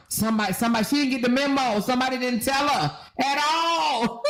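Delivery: ordinary talk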